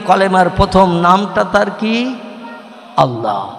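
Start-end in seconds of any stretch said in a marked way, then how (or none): none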